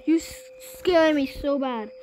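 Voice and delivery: normal talking voice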